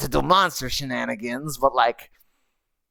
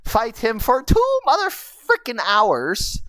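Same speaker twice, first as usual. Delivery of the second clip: ordinary talk, nothing marked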